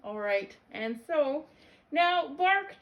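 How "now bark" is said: high, loud bark command. Acted. Vet asking George to bark, loud high command. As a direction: order the dog loudly, pitch raised. Dog told to bark again.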